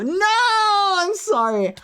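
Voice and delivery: very high wail of sympathy, easing down; mock dismay. Streamer hearing the donor didn't make acapella, high mock dismay. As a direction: wail it in hammed-up commiseration. Hammed-up sympathy for failed audition.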